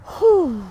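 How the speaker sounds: a loud shaky whew; shaky exhale. Real, not acted. Grief wave hitting her mid live, a loud whew. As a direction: let the breath rush out as grief hits.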